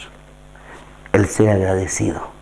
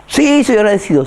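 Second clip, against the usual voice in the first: mock listener reply; 11 semitones higher, 4 dB louder than usual. Voicing a listener's easy answer.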